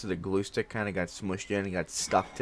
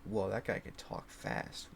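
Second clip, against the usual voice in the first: whispered, awed aside; mock awed viewers; 4 semitones lower, 10 dB quieter than usual. Viewers react to fast talker, whispered awe. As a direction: breathe it out like an impressed onlooker. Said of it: "you guys would be like".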